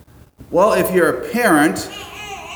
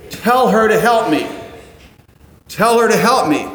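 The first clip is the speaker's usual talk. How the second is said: higher, louder order; commanding demand; 6 semitones higher, 5 dB louder than usual. Retold or imitated. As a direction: bark it as a fed-up command to Jesus. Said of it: "uses the imperative mode to command Jesus".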